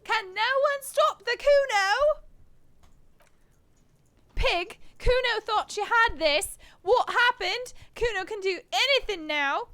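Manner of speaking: high, loud bratty taunts; bratty kid gloating. Acted. Kuno taunting the fallen detective, high gloating. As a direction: gloat like an unstoppable brat.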